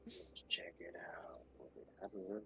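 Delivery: soft unvoiced whisper. Real, not acted. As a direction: whisper it to yourself. Whispering while checking.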